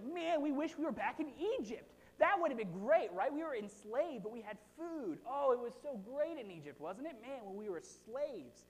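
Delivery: high, mocking whine about Egypt; sarcastically pine for slavery; whiny nostalgic Israelites. Preacher mocking the people's longing for Egypt in a high whine. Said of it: "People were like".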